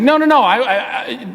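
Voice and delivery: high, stuttering denial; flustered backpedalling. Acted. Man trying to undo a misunderstanding, flustered stammering denial. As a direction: start protesting, then falter on the words.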